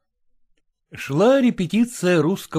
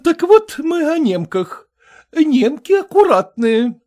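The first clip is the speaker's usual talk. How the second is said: high, brisk society matron; brassy lady voice; 10 semitones higher, 4 dB louder than usual. A narrator's reading. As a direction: play the bossy choir mistress. Kolkina voiced by reader.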